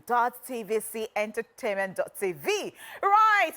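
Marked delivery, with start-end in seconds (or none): 3.0-3.5 loud exclamation. Real, not acted. Host's loud opening exclamation.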